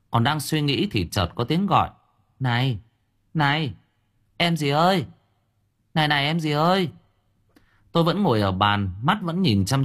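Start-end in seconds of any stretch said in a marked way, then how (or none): 2.4-6.9 higher coaxing call; higher flirty calling voice.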